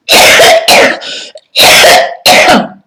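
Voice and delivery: loud, harsh real cough; hard coughing fit. Real, not acted. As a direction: cough hard, breaking off mid-speech. Ill, coughing fit while talking.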